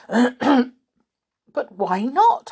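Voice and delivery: slow, high, loud astonished question. A narrator's reading. Joe challenges the goblins' ban, astonished. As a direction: ask it in drawn-out disbelief. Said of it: "asked Joe, astonished".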